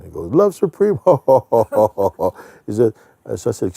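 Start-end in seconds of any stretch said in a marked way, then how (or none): 0.3-2.3 much higher, louder laugh at the name. Retold or imitated. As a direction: chuckle hugely at the name. Old man laughing at incense name.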